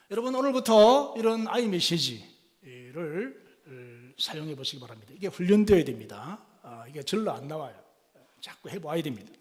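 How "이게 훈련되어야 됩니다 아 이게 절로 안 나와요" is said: ordinary delivery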